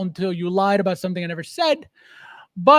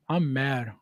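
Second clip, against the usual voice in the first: mock sulking; 8 semitones lower, 4 dB quieter, much slower than usual. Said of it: "Someone is mad they didn't get invited".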